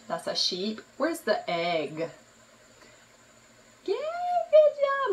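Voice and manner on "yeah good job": high, slow, squealing praise; excited praise. Real, not acted. Mother praising her toddler, high and delighted.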